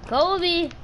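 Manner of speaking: loud, high game shout; calling out. Real, not acted. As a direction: holler it as a throw call.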